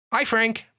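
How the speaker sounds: parrot greeting. Acted. Parrot greets the husband, high and chirpy. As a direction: chirp the name like a bird.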